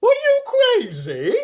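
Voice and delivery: very high, drawn-out loud screech; woman's shout. A narrator's reading. Woman in the crowd objects to the offer, shouting. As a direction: shout it high, stretching the words. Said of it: "shouted a woman".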